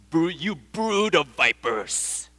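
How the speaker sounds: slow, weighted furious insult; furious curse. Shown as a demonstration. John the Baptist cursing the Pharisees, slow and furious. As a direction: curse them with every word. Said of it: "You gotta be angry to say such a thing".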